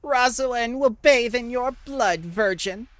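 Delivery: high, loud battle threat; villain's scream. Acted. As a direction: scream the threat as a wounded foe. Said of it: "and he screams out".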